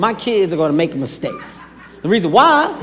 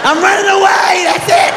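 high mock runaway shout; kid tantrum; 10 semitones higher than usual. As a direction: storm off like an upset child. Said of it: "emotional ass kid".

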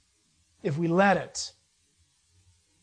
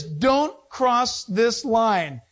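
each word drawn out slowly; slow, spelled-out warning; very slow sign reading; much slower than usual. Retold or imitated. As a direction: read the sign slow and deliberate. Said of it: "The sign says".

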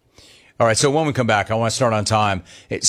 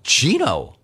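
pleading disbelief; 9 semitones higher than usual. Retold or imitated. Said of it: "I'm like".

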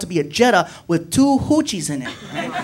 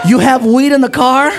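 higher, louder shocked question; 6 semitones higher, 7 dB louder than usual. Retold or imitated. Driver learning of weed in car, shocked. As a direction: gasp it in disbelief.

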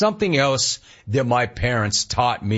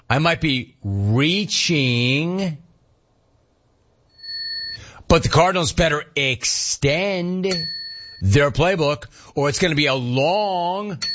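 slow, higher, stretched-out words; drawn-out joke reading; 4 semitones higher, slower than usual. Acted. Host reading a listener's giraffe pun, drawn out. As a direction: stretch the key words out long.

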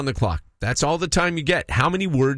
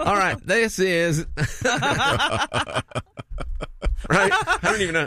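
laughing; 7 semitones higher than usual. Real, not acted. Laughing at the Southern idea.

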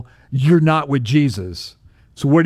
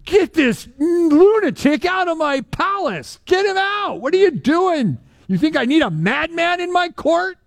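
high, outraged royal bark; mock-furious king ordering him out; angry king; 13 semitones higher than usual. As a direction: throw the madman out, indignant. Angry king throwing out madman.

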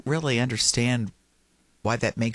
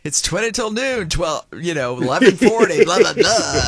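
teasing mimicry; 6 semitones higher, 6 dB louder than usual. Teasing mimicry of host's time calls.

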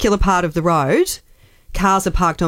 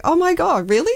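delighted disbelief; 10 semitones higher than usual. Retold or imitated. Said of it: "he was like".

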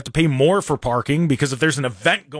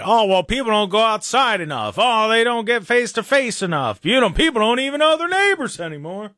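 higher, sing-song mocking complaint; mock the common whining; 7 semitones higher than usual. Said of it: "I don't know why I said it mockingly".

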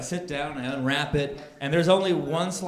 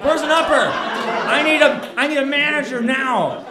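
high, loud acted outburst; demanding irate customer; 10 semitones higher, 8 dB louder than usual. Customer demanding a manager from the clerk, irate outburst. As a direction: make a big scene as that guy. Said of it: "and I become that guy".

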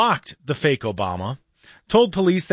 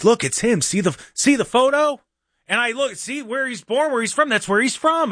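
high, eager insisting; imitating an excited mom sure he's real; insistent friend's mom; 9 semitones higher than usual. Mom shows her son's friend the fake [name] profile. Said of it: "she's like".

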